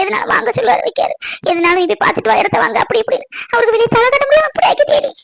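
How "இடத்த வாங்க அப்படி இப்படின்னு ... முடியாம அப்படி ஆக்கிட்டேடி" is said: usual speaking voice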